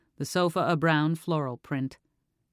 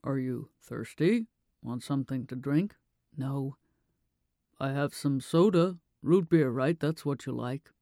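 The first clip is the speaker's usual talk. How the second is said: lightly voiced anxious father; 2 semitones lower than usual. A narrator's reading. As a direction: offer the drink like a nervous dad avoiding the topic.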